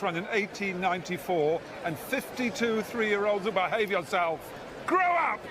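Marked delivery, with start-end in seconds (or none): 4.9-5.4 sharp high yell at the crowd; shouting. Real, not acted. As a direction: bark a scolding at hecklers. Commentator scolding rowdy crowd.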